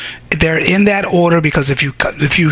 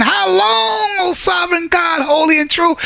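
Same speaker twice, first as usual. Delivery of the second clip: souls crying out; 10 semitones higher than usual. Acted. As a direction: cry out to heaven. Martyrs crying out loud.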